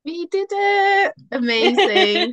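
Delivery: joyful high cheer; excited. Real, not acted. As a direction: burst out with delight. Relief after finishing the recording.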